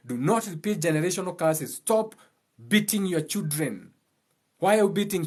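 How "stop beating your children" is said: ordinary talk, nothing marked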